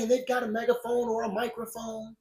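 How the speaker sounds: usual speaking voice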